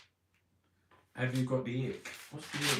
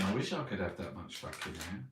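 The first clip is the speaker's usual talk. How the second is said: ordinary talk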